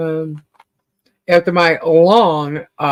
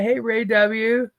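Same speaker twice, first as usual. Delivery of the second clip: ordinary talk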